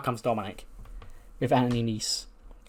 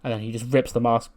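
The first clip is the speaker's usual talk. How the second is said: usual speaking voice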